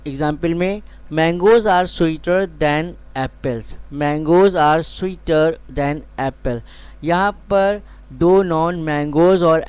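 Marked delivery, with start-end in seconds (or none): 1.1-6.6 very slow, clear classroom reading; slow example sentence. Shown as a demonstration. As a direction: read it slowly so students can write. Teacher reads example sentence twice.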